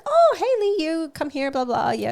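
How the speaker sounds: high, bubbly hello to a school mom; chirpy greeting. Retold or imitated. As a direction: squeal a bright friendly hi. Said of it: "So I'm like".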